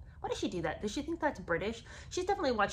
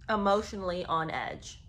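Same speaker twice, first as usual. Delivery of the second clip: ordinary talk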